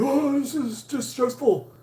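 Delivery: high-pitched, strained imitation of a stressed-out worker; screaming flooring guy. Flooring guy whining to the homeowner about stress, high mock wail. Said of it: "he just screamed".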